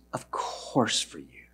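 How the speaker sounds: soft, slow, low and tender. Acted. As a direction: say it gently to the maid. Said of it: "tenderly and compassionately".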